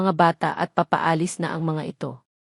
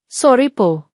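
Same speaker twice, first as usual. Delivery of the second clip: fake sorry; 4 semitones higher, 5 dB louder, slower than usual. A narrator's reading. Fake apology after spilling milk.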